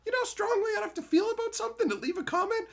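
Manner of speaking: very high, rushed disbelief; incredulous. Retold or imitated. Explaining why he never left comments, incredulous. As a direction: exclaim it high and fast, amazed.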